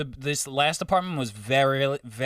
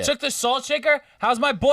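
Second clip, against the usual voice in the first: high, loud mock indignation; mock outrage; 11 semitones higher, 5 dB louder than usual. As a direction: keep hamming the outrage. Hammed outrage continues.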